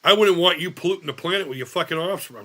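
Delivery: ordinary talk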